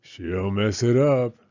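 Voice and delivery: put-on slow, sulky grumble in a kid voice; grumbling. A narrator's reading. Jealous brother grumbling about his sister, sulky. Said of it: "grumbled Jake".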